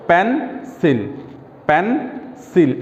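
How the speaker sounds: পেন্সিল said as two separate syllables, pen, sil; split into syllables. Shown as a demonstration. Teacher showing a two-syllable word, split syllable by syllable. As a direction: break পেন্সিল in two with a gap between. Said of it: "ডাই সিলেবলে পেন্সিলে দেখো".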